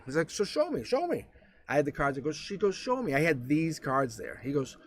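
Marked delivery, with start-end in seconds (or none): 0.3-1.3 high-pitched, quick mimicry of a doubter; mock challenge. Skeptic asking the reader for proof, high mock challenge. 2.8-3.2 much higher, mimicking a doubter; mock challenge. Skeptic daring the reader, high mock challenge.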